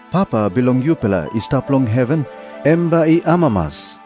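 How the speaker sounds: usual speaking voice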